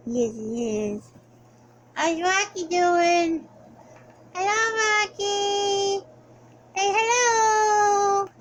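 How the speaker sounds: high, sing-song coo to the dog; pet baby talk. Acted.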